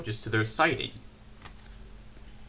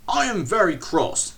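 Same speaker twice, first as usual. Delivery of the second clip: higher, louder stern rebuke; very cross; 6 semitones higher, 8 dB louder than usual. A narrator's reading. Controller scolds Percy, louder and cross. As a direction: scold him firmly. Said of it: "said the fat controller".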